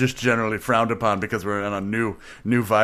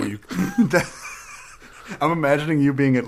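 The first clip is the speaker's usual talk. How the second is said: usual speaking voice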